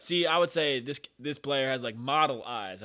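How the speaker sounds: ordinary talk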